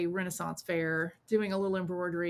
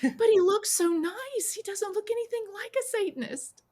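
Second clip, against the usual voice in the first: put-on naive teen voice; 13 semitones higher, 4 dB louder than usual. Sheltered teen marveling to the friend, high and naive. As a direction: play it wide-eyed and high, shocked he seems so nice. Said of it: "I was like".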